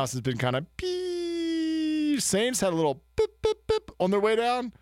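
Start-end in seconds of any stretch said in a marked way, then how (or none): 0.8-2.2 long high-pitched "peee" sound effect; imitated falling noise. Shown as a demonstration. 3.2-3.9 short, high "pip" sound effects; imitated little blips. Shown as a demonstration. Imitates small blips upward.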